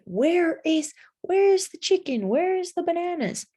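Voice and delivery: high lively questioning; animated question. Shown as a demonstration. Teacher posing question, high pitched.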